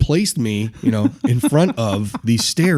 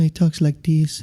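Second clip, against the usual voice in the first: higher, mild-mannered imitation; put-on passive voice, pitched up; quiet, passive talker; 5 semitones higher than usual. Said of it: "very quiet and passive in the way he talks".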